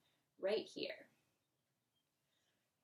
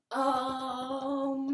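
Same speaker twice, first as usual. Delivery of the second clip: loud, higher om like Tarzan; 3 semitones higher, 10 dB louder than usual. Shown as a demonstration. Teacher doing a silly Tarzan om for kids, loud. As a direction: beat your chest and bellow it. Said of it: "let's try a Tarzan ohm".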